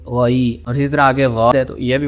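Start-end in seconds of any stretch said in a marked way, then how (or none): 0.1-0.6 غی with flat, unrounded lips and a full mouth; unrounded demo. Shown as a demonstration. Teacher shows students the normal unrounded غی. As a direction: say غی without rounding the lips. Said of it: "منہ کے gap کو آپ نے بھرنا ہے".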